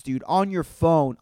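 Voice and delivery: usual speaking voice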